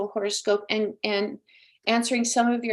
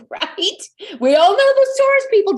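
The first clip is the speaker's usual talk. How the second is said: laughing; 9 semitones higher, 9 dB louder than usual. Real, not acted. Laughing at her horoscope joke.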